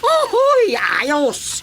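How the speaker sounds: high whooping yelp then settles; delighted cheer. Acted. Nephew cheering at his uncle's offer, delighted yelp. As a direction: cheer it with glee.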